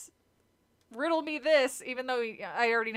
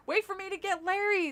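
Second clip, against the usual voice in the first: calling out; 9 semitones higher than usual. Acted. Calling after the drifting canoe.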